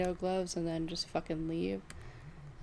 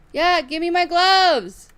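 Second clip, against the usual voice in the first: slow, loud, high demand at a teammate; yelling at a teammate to hand over the gloves; angry yell; 7 semitones higher, 10 dB louder, slower than usual. Real, not acted. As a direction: bellow it, fed up and furious.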